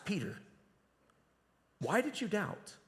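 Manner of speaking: soft, caring why; Jesus gently asking Peter, caring; gentle inviting question. Shown as a demonstration. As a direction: ask it tenderly, inviting reflection. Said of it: "the tone was perhaps".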